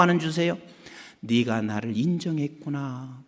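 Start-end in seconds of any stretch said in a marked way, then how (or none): 1.2-3.2 soft, low approval; tender divine voice. Retold or imitated. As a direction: acknowledge them gently. God's voice quoted softly.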